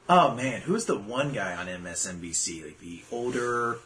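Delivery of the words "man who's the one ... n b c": usual speaking voice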